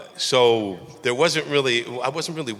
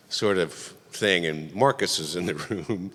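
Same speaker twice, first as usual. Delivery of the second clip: normal talking voice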